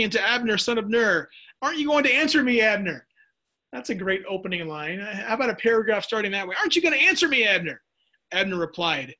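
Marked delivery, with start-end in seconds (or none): 1.6-3.1 higher, louder and fast, calling across the hills. Acted. David calls out to the army. 6.6-7.8 much higher and louder, a challenge shouted across; calling out. Acted. David calls out again.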